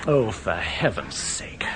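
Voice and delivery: whispered, slow and exasperated; a fed-up whisper through the row. Acted. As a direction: hiss it so the stranger won't hear. Quarrel hushed near a stranger.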